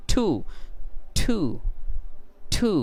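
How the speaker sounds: টু with a breathy, aspirated t, almost ঠু. Shown as a demonstration. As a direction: puff air on the t of টু. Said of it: "ট sound আসলে কিছুটা ঠয়ের মতো করে". (ঠয়ের মতো করে টু)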